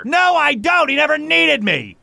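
high, loud protest; angry denial. Acted. Big brother rejects being an example, angry. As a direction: shout the denial back at them. Acted quarrel between brothers.

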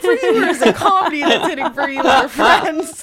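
high, laughing mock survey question. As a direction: laugh through a nosy question.

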